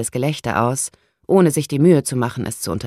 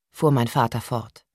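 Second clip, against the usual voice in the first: ordinary delivery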